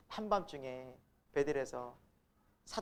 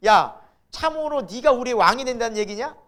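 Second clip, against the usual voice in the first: loud, raised voice mocking indignation; indignant brothers; 4 semitones higher, 8 dB louder than usual. Brothers to Joseph about his dream, acted indignation. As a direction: play the fed-up older brothers. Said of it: "막 열받는 거예요".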